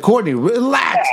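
high, slow, drawn-out plea; retold yell. Friend retells restraining drunk Courtney, exasperated yell. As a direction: call out high to rein someone in.

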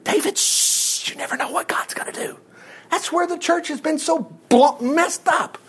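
hushed, secretive whisper. Acted. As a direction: lean in and whisper it. Hushed aside to the congregation.

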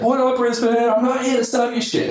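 very high, hyped-up stage holler; Gary. Retold or imitated. Speaker greeting a Brisbane crowd as he runs out, high hyped impression. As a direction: imitate a speaker bursting onto stage. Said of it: "Gary runs out. The first thing he says".

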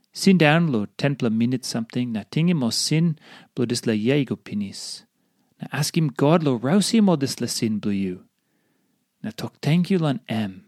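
usual speaking voice

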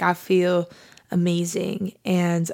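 normal talking voice

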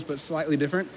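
ordinary talk

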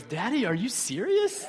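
soft, imitated one-year-old in disbelief; mock little-kid disbelief, swooping up at the end; toddler voice. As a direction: play a baby questioning daddy. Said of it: "my one-year-old looked at me and goes".

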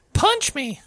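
high-pitched imitation of a creepy kid; game kid's voice. Player imitates kids overheard in the game, high creepy kid voice. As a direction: mimic an eerie little kid in a high voice. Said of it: "I swear I heard them say".

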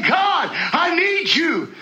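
high, slow shouted plea; crying out to God. Acted. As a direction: cry it out from the soul, slowly. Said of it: "It's screaming. It's saying".

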